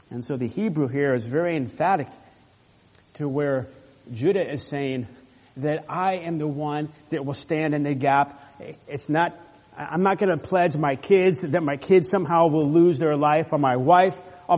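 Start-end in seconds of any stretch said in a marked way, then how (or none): none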